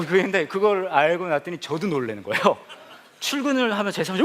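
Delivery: normal talking voice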